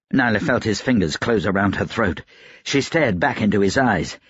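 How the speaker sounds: ordinary delivery, nothing marked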